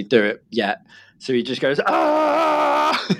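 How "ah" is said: high mock toddler scream; squealing Pee Wee Herman scream; kid's scream. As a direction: shriek it like a little boy. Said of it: "Just shouts, screams like Pee Wee Herman".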